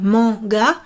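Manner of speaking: normal talking voice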